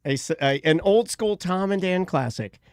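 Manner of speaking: low, steady announcement; announcer voice. Acted. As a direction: introduce it like a show host. Introduces the song.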